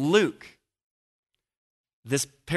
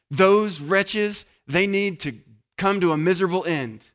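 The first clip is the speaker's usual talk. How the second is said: raised, pronouncing harsh judgment; condemning wretches; 5 semitones higher, 4 dB louder than usual. Retold or imitated. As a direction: spit out the verdict on the wretches. Leaders condemning, voiced again.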